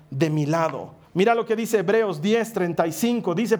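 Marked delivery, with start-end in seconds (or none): none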